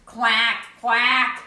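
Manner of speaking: loud quacking duck. Acted. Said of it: "George went".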